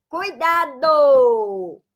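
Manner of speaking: "Cuidado" stretched very slowly for emphasis; drawn-out warning. Shown as a demonstration. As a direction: warn them, draw out "Cuidado". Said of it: "teacher warning students". ("Cuidado" bem arrastado, em tom de alerta)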